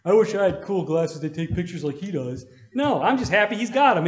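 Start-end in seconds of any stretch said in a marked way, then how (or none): 0.0-2.5 mock envious whine. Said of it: "I'm not like".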